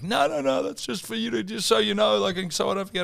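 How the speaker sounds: high-pitched placating wife voice; imitating wife. Imitating wife excusing her list.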